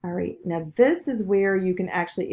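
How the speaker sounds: normal talking voice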